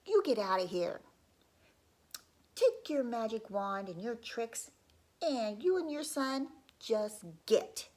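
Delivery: loud, slowing at the end; angry townspeople. Acted. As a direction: shout them out of town. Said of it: "People said to the father".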